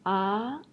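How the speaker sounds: "Ah" in the Chinese second tone, rising. Shown as a demonstration. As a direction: slide "Ah" up like a question. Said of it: "The second one is a rising tone".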